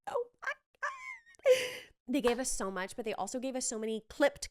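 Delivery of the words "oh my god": very high, slow excited squeal; squealing fan. Real, not acted. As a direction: gush like a thrilled fan. Overwhelmed fan reaction.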